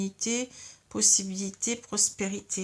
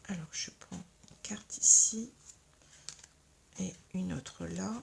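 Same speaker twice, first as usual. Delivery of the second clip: hushed whisper to the cards; 4 dB quieter than usual. Real, not acted. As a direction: whisper while drawing cards. Asks guides, draws cards.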